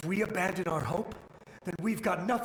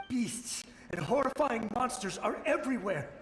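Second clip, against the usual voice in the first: climbing higher with each word, dread building; rising dread; 6 semitones higher than usual. Acted. Soldier warning strangers of the monsters, rising dread. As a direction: let the fear mount phrase by phrase.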